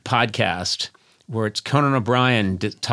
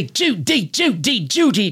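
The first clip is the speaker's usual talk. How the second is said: put-on high, clipped-free old-movie Cary Grant voice; cheesy retro celebrity impression; hacky Cary Grant; 9 semitones higher than usual. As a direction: do a deliberately bad Cary Grant. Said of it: "was Cary Grant".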